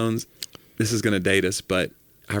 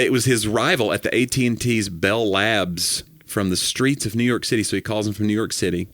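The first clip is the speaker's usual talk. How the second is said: usual speaking voice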